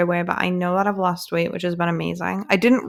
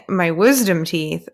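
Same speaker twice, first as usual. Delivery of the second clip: normal talking voice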